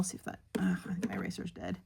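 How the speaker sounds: whispered aside to herself. Real, not acted. Vlogger whispers to herself about a dead eraser. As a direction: drop to a breathy whisper while drawing. Muttering to herself over drawing.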